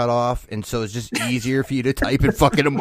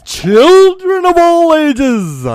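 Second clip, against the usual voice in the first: high, slow, stretched-out loud call; high held announcer cry; 13 dB louder, much slower than usual. Acted. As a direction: draw it out high like a circus barker. Host's loud show opening.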